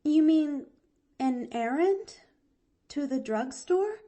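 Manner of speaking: put-on high, questioning girl voice; a little uncertain. A narrator's reading. Girl puzzled by the doctor's request, uncertain. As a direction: ask hesitantly, piece by piece. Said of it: "a little uncertainly".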